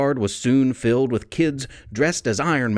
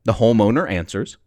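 usual speaking voice

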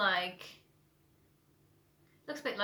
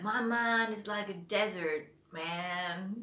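slow, drawled, stop-start mock drawl; a hint of a laid-back Texan drawl; slow McConaughey drawl; much slower than usual. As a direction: draw it out with long breaks, lightly mocking. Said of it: "stop starts when he talks".

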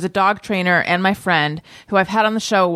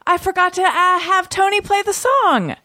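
dismayed realization; 9 semitones higher than usual. Real, not acted. Host realizing she forgot to play the song, high dismay. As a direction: wail it lightly over your own slip.

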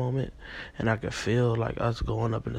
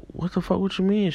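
retold indignant disbelief; 9 semitones higher than usual. Dreamer confronting his girlfriend over cheating, high retold indignation. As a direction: imitate being taken aback, pitched way up.

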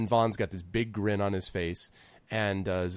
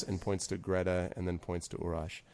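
ordinary delivery

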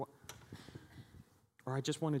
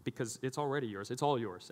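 usual speaking voice

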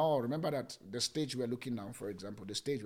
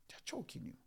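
whisper; 9 semitones higher, 14 dB quieter, faster than usual. Acted. Preacher warns the congregation in a whisper. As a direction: whisper it, high and breathy.

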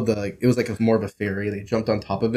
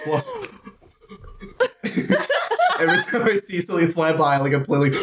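laughing; 10 semitones higher than usual. Real, not acted. Laughing while telling.

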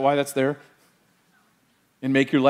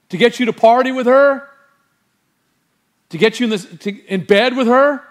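loud, higher, urgent sermon delivery; fiery preaching; 5 semitones higher, 4 dB louder than usual. Acted. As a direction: preach it at full force. Preaching at full force.

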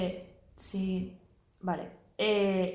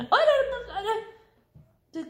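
dismayed squeal; 12 semitones higher, 4 dB louder, much slower than usual. Real, not acted. Reacts to something on screen.

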